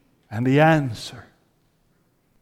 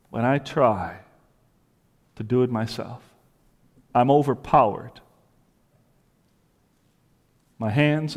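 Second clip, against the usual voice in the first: hushed confessional sermon; 2 semitones lower, 4 dB quieter than usual. Acted. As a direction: confess it quietly as the trapped sinner. Voicing Paul's inner struggle, hushed.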